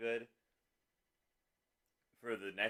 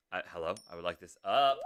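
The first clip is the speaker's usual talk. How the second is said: ordinary delivery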